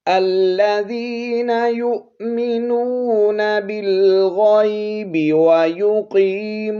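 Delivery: slow, high, melodic flowing tilawat; fluent Quranic recitation. Shown as a demonstration. Teacher recites both verses fluently for students. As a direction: chant the verses smoothly without pause. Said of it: "इनको रवानगी के साथ पढ़ लेते हैं".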